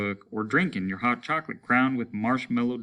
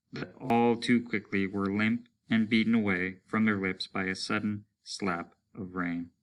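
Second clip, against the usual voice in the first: ordinary delivery, nothing marked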